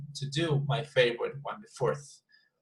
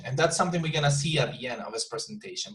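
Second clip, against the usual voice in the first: ordinary talk, nothing marked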